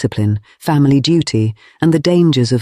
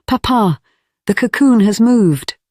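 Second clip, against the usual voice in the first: put-on high, eager little girl; a small girl bursting in; excited child; 10 semitones higher than usual. A narrator's reading. Child Lucy voiced excitedly.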